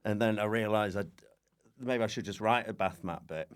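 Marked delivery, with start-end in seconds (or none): none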